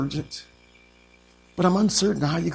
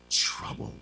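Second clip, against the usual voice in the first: whispered; 10 dB quieter than usual. Acted. Word dropped to a whisper.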